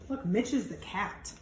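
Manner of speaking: ordinary delivery, nothing marked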